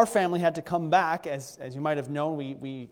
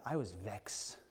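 Trinidadian vexed; 8 semitones lower, 13 dB quieter, slower than usual. Shown as a demonstration. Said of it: "as they say in Trinidad".

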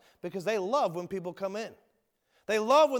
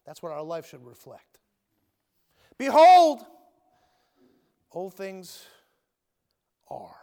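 normal talking voice

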